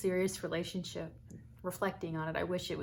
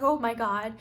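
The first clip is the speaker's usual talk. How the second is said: loud, higher re-enacted shock; raised-voice astonishment, retold; overwhelmed; 4 semitones higher, 9 dB louder than usual. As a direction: act out being floored by a proposal.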